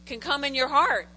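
ordinary talk, nothing marked

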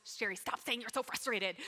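ordinary talk, nothing marked

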